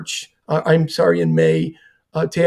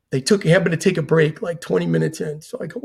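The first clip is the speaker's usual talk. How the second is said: usual speaking voice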